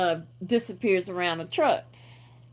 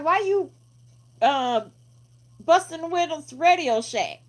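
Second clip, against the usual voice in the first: higher, demanding tone; confronting voice; 7 semitones higher than usual. Retold or imitated. The guy in pink confronting the window breaker, lightly voiced and higher. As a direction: call him out on it. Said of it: "he was like".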